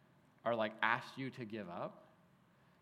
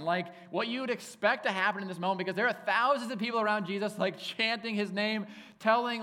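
much higher and louder, savouring the epic scene; vivid excitement; 7 semitones higher, 6 dB louder than usual. Real, not acted. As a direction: paint a grand royal entrance.

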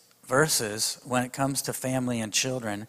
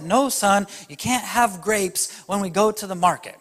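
mom voice; 7 semitones higher, 4 dB louder than usual. Retold or imitated. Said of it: "Samson's mom said".

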